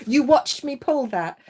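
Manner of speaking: excited. Real, not acted. Amazed at the card.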